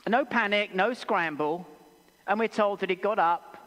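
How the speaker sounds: normal talking voice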